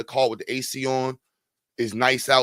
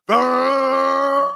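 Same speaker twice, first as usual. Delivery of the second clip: high shouted engine roar; engine screaming; 9 semitones higher, 5 dB louder than usual. Retold or imitated. The car speeds into a wall, high shouted engine roar.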